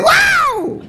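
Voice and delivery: very high, laughing "Wow"; delighted squeal into the mic; surprised shout. Retold or imitated. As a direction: blurt it out amazed, way up high. Her answer into the mic.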